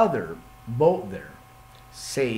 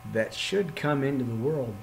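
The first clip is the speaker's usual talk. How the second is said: normal talking voice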